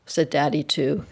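usual speaking voice

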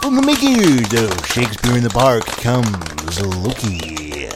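loud, booming trailer announcer; movie trailer voice. Acted. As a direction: sell the sequel like a blockbuster trailer.

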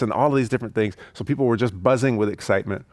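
ordinary talk, nothing marked

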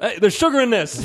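loud, high retold protest; mock outrage. Southern friend objecting to the cornbread, loud mock outrage. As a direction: imitate an appalled diner calling it out. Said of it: "Like it was wrong".

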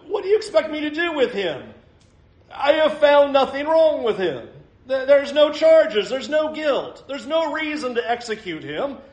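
high, urgent pleading as Pilate. Acted. Preacher voicing Pilate pleading with the crowd. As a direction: Pilate begging the crowd to see reason.

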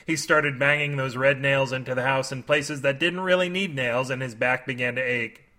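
plain reading voice

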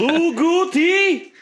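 high, loud, slow drawn-out Lou Goody; mock cop mispronunciation. Friend mocking the cop's misreading with a slow, loud high voice. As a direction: stretch out Lou Goody in a goofy voice.

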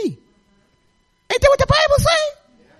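high, drawn-out preacher's cry; preaching call. Real, not acted. Testifying woman calling out to the congregation, high slow preaching cry. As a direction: stretch it out to rouse the church.

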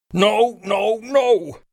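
high desperate yell. Acted. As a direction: yell it, losing control. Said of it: "I yelled".

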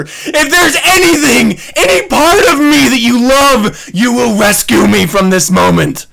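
high, loud hammed-up begging; mock desperate plea. Husband begging his wife to rescue him, loud mock despair.

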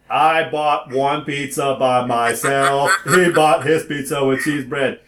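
slow, deliberate word-by-word explanation; exasperated spelling-out. Retold or imitated. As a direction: spell it out slowly for someone not getting it. Spelling it out to confused employee.

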